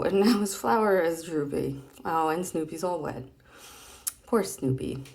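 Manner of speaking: ordinary talk, nothing marked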